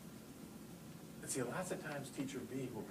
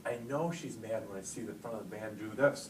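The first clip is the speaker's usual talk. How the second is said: normal talking voice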